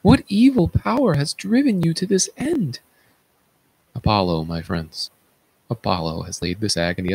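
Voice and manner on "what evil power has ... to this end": high, appalled outcry; high-pitched horrified question; horrified cry. Acted. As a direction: cry out in horror at what he has done. Chorus horrified at blinded king.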